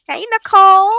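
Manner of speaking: loud, high hello; excited greeting. Real, not acted. Host hails Nicole joining the stream, loud and excited. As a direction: call out happily to a friend.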